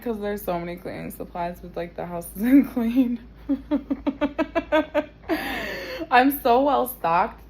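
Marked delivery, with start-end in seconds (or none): 2.4-7.5 loud laugh into words. Real, not acted. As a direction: laugh through it. Laughing about well-stocked house.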